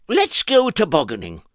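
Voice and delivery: very high squeaky Mister Nonsense; high silly voice. Acted. Narrator voicing Mister Nonsense, high and squeaky. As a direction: pipe it up like a daft little man.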